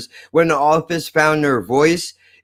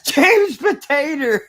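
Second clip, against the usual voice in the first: real laugh through the words; cracking up, higher pitch and slower; 12 semitones higher, slower than usual. Real, not acted. Cracking up at a nickname.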